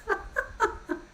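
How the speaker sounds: high, breathy burst of embarrassed laughter. Real, not acted. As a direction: laugh helplessly at yourself. Laughing at her own blunder.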